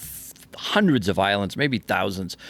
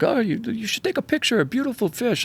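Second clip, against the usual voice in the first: high, far-off mock voice of the mate; imitating the mate; 13 semitones higher than usual. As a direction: mimic the fishing mate, pitched way up. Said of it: "the mate was like".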